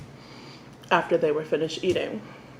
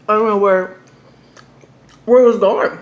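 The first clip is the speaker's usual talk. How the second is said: louder, fed up and baffled; exasperated; 3 semitones higher, 9 dB louder than usual. Real, not acted. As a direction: vent the frustration loudly. Frustration about lost phone storage.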